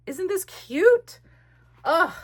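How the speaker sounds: high, slow delighted squeal; gushing. Real, not acted. Showing viewers her page, high and gushing. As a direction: coo over it.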